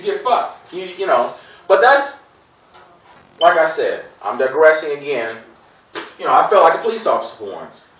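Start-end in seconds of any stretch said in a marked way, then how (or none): none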